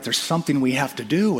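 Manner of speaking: ordinary talk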